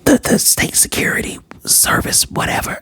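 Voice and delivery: hushed, urgent stage whisper; all breath, no voice; whisper talk. Retold or imitated. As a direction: imitate someone whispering on a stakeout. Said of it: "I love the whisper talk".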